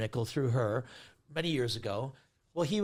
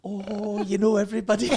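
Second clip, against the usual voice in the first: imitated impressed mum; 3 semitones higher, 3 dB louder than usual.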